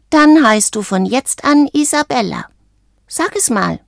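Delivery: put-on high, decreeing young voice; self-important little frog. A narrator's reading. Frog naming the bird Isabella, high and self-important.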